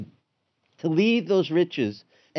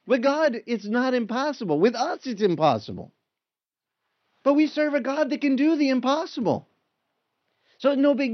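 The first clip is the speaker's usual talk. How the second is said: higher, building joy in God's power; rising preacher fervour; 9 semitones higher than usual. Acted. Preacher voicing Jesus to the stunned disciples, high and fervent. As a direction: lift it up, proclaiming what God can do.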